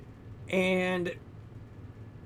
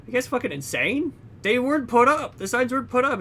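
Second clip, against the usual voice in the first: higher and louder, incredulous outburst; higher, louder mock protest at the studio heads; indignant protest; 9 semitones higher, 5 dB louder than usual. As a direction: blurt it out in disbelief, voice jumping up. Guy protests signs weren't posted.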